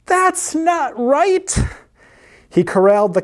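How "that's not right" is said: high, drawn-out howl of protest; frustrated high-pitched wail. A narrator's reading. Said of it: "Marigold howled".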